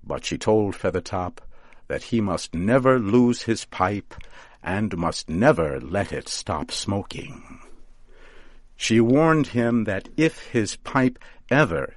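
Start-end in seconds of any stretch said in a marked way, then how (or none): none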